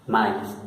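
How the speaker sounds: "maíz" run together as ma-is, ending in S; French "maíz": two vowels, no pause between; both vowels sounded. Shown as a demonstration. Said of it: "sino separado". ("maíz" seguido, ma-is, sonando las dos vocales)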